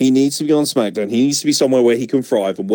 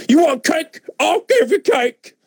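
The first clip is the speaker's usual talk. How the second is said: mock angry Kalisto. Mocking Kalisto's cake attack.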